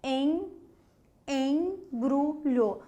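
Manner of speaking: em said alone, then embrulho very slowly; slow nasal em in embrulho; nasal vowel demo. Shown as a demonstration. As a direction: drag out the em of embrulho. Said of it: "Tá vendo que já existe uma nasalização".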